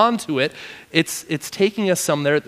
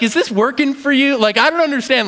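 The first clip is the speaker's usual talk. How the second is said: much higher, puzzled questioning; incredulous God; 9 semitones higher than usual. Acted. God puzzled at Jonah stewing on the hill, much higher. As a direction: sound baffled by his sulking.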